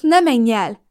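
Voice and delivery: woman calling after a man leaving. A narrator's reading. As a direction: shout after him. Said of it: "iramodtam utána".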